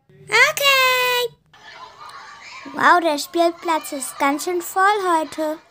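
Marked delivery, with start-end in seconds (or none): 0.1-1.3 shouted okay. Acted. Child shouts back.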